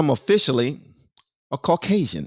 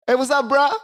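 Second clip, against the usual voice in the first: casual street greeting; 11 semitones higher than usual. Retold or imitated. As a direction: greet a buddy on the street, hyped. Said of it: "I can no longer greet you like this".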